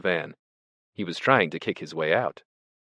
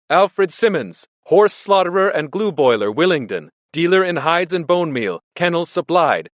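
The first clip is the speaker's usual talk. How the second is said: raised, emphatic sign-reading; loud reading out; 9 semitones higher, 7 dB louder than usual. A narrator's reading. Benjamin reading the van's lettering to silent animals, loudly. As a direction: read it out high and loud, item by item. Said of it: "he read".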